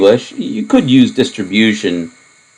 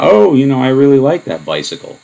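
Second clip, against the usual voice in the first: higher, bright fake enthusiasm setting up a joke; mock sincere gushing; 7 semitones higher than usual. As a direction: gush like you mean it, then pull the rug. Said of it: "it was kind of in style to say".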